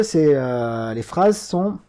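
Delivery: ordinary talk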